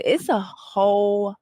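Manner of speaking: slowed, drawn out emphasis. Real, not acted. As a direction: stretch the words for weight.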